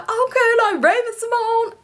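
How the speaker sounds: high-pitched mimicked approval; high, teasing imitation of people reacting; mimicking acquaintances. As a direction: play back their reaction way up high. Mimicking people's reaction to her name.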